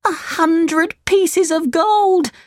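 high, slow, marvelling puppet voice; wide-eyed wonder at riches; excited Pinocchio. A narrator's reading. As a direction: savour it in a high boyish pitch.

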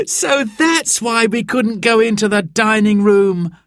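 high little laugh into the line. A narrator's reading. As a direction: laugh as you realise the secret. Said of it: "laughed Simon".

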